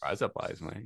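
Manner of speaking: soft Australian "Rise up lights, mate", run together; Australian accent. Shown as a demonstration. Showing a friend the trick, Australian accent. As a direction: blend "Rise up lights" into Australian razor blades. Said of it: "teach somebody an Australian accent".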